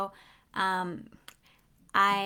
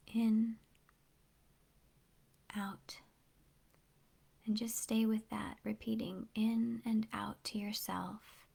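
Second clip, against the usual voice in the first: guided meditation; 5 dB quieter than usual. Real, not acted. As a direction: ease them gently into calm, hushed and unhurried. Leads a breathing meditation.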